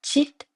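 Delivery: Chit, with a ch at the start, said high; ch sound. Shown as a demonstration. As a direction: say Chit with a ch, not a k. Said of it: "le C il se dit Che". (Chit avec un C dit tché)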